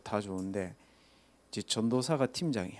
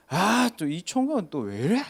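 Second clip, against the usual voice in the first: put-on annoyed old man; 6 semitones higher, 4 dB louder than usual. Grandfather annoyed at evangelist.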